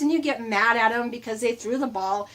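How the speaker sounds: ordinary delivery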